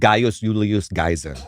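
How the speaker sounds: low "Gaius Julius Caesar", Y for J, hard K; classical Latin pronunciation. Shown as a demonstration. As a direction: say "Gaius Julius Caesar" as "GUY-os YOO-lee-us KAI-sar". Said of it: "what you say is the correct Latin pronunciation".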